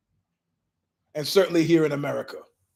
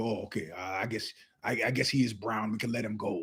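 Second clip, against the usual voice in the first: very low, much softer offhand murmur; much softer, low mumble among themselves; muttered aside; 8 semitones lower, 10 dB quieter, faster than usual. Retold or imitated. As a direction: shrug it off under your breath. Guys voiced shrugging it off.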